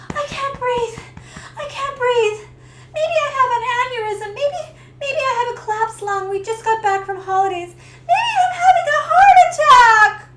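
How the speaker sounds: high, loud, fast panicked cry; frantic, half laughing, half crying. Retold or imitated. Retold panic attack.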